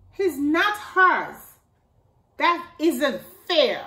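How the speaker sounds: mild mock outrage; indignant protest. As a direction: protest like a wronged little kid. Llama protests the unfairness.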